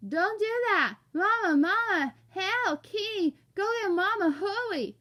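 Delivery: high, loud muffled screams; panicked wailing. Acted. Stuck boy goes nuts as Dad tugs, panicked wailing. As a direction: holler for Mama through stuck lips.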